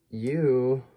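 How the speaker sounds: ordinary talk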